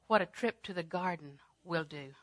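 normal talking voice

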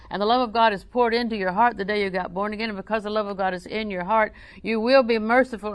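ordinary talk, nothing marked

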